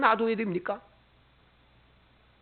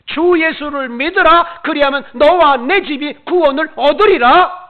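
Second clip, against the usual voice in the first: proclaimed scripture; 8 semitones higher, 13 dB louder than usual. Acted. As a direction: declaim the verse like a herald. Reciting scripture aloud.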